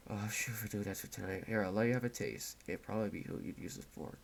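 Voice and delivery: put-on a captor mother murmuring over her bandaged child; creepy quiet mother. Voices creepy mother, bedside.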